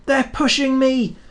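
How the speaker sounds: a runaway engine panicking; panting in fear. A narrator's reading. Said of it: "he panted".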